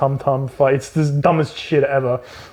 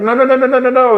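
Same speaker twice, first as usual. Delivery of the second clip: high, louder frantic protest; panicked no; 9 semitones higher, 5 dB louder, faster than usual. Retold or imitated. Ryan Gosling fighting for the camera, frantic. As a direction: yelp no over and over. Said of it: "Ryan Gosling's like".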